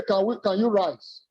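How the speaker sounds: ordinary delivery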